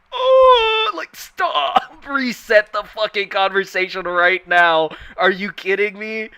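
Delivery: high, loud frustrated yelling with a laugh; exasperated outburst. Retold or imitated. Friend vents to a friend, loud frustrated shout. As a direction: shout in disbelief that they misread you. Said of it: "you're just like".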